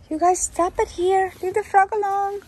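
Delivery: loud high scolding call. Real, not acted. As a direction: shout across the yard at the cats. Cats chasing a frog.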